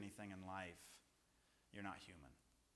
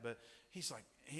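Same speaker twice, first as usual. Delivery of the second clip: usual speaking voice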